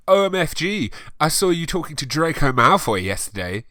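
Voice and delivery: high, loud gushing squeal; excited. Acted. Friend gushing about Draco, excited. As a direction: squeal it like a thrilled schoolgirl. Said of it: "she said excitedly".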